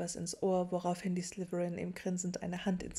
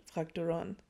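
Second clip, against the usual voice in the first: ordinary delivery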